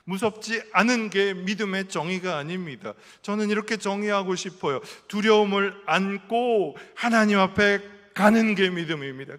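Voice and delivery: ordinary talk, nothing marked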